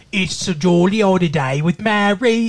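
terrible mock British accent, hammed up. Teasing a beloved actor's failed accent, mock British accent. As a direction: badly fake a jolly British accent. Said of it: "it's a terrible, terrible accent".

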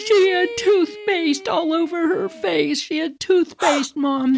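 put-on high-pitched tearful wail of a kid; crying child. Crying kid telling mom about the dog, high imitated wail. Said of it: "And I'm crying".

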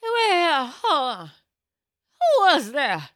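high, loud hammed-up cry; crazy lady. Crazy lady outside stage door questioning the assistant, imitated shrieking. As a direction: imitate a frantic stranger calling out. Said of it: "the girl goes".

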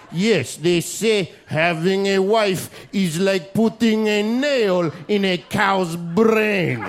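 slow, hammed-up Israeli accent delivering a joke; deadpan foreign joke, dragged out slowly; mock Israeli telling an ancient joke; Israeli guy. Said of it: "They're just like".

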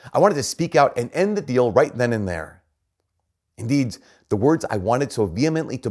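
impassioned reading. Acted. Preacher voicing the imprisoned priest's inner struggle, impassioned and loud. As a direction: let the priest's frustration build into a preached cry.

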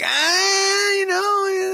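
high, loud mocking coach voice; coach impression. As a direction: shout it as a cagey coach. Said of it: "been like".

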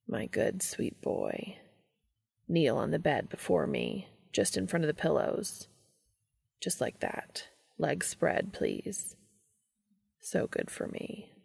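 a quiet reward spoken close; soft commanding whisper; Cass, hushed praise. A narrator's reading.

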